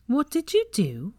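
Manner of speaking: high-pitched accusing question; suspicious demand. A narrator's reading. Suspicious girl confronts her brother.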